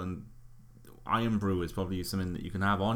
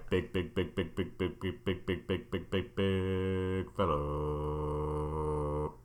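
fading repeated echo, slowing; echo effect; 3 semitones lower, 5 dB quieter, much slower than usual. Pretend. Mock sponsor echo jingle.

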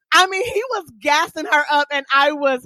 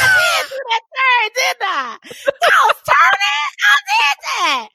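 much higher, shouted, delighted self-praise; proud squeal; 13 semitones higher than usual. Retold or imitated. Learner driver thrilled with her turn, high proud shout. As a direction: squeal with pride over a small win. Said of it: "I was like".

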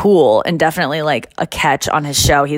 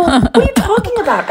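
giddy disbelief; 14 semitones higher than usual. Retold or imitated. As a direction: squeal it through a laugh. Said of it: "I go".

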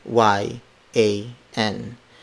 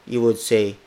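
normal talking voice

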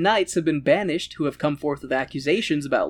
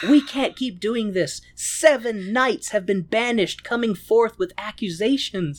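higher, slow melodramatic pleading; Jenny, distressed plea; 5 semitones higher than usual. Acted. Secret lover warning Lancelot about banished knights, mock distressed plea. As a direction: act out a troubled lover's protest.

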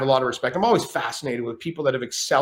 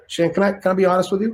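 higher put-on De Niro, earnest; 7 semitones higher than usual. De Niro asking to be honest with Shane, put-on voice. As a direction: ask it gently as De Niro. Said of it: "he said".

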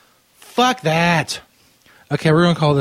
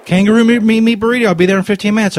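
higher and louder, stumbling with glee; hammed-up excitement; 6 semitones higher, 5 dB louder than usual. Prank caller confirming his kangaroo order, mock thrilled. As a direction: gush over the burrito.

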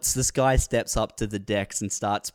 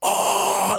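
high drawn-out crowd "oh"; mock cheer of an ecstatic crowd; imitated crowd roar; 10 semitones higher than usual. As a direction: mimic a club going wild. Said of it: "like, losing their mind".

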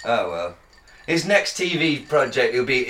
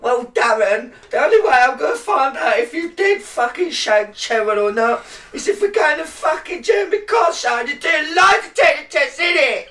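mock chav shout; 10 semitones higher, 4 dB louder than usual. Mocking a Jeremy Kyle guest.

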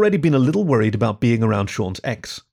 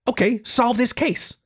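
much higher, slow, bossy squeak; Perry ordering Sean to solve it; demanding rat; 13 semitones higher, slower than usual. Acted. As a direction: insist like it's an order.

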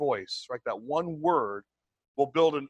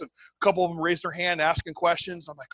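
normal talking voice

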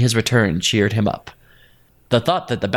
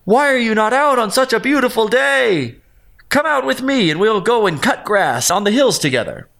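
much higher, friendly rabbit voice; calling out; 10 semitones higher than usual. A narrator's reading. Rabbit lures the badger out of his den, high cheerful call. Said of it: "The rabbit called out".

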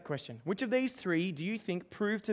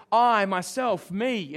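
"I, myself, me" each word punched much louder; emphatic I; 8 dB louder, much slower than usual. Shown as a demonstration. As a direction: hammer "I" and "myself" as if claiming it alone. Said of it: "It's emphatic".

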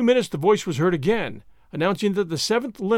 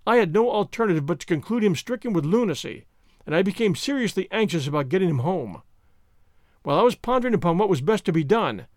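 ordinary delivery

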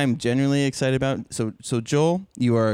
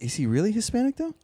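quoting a friend; 11 semitones higher, faster than usual. Retold or imitated. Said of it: "and then she goes".

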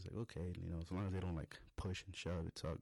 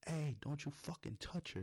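high-pitched mock yell, not full volume; retold scream; 8 semitones higher than usual. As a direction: re-enact yelling a warning, pitched up. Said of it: "I scream out ... like, loud".

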